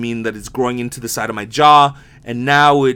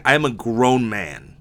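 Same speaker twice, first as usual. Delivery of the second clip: normal talking voice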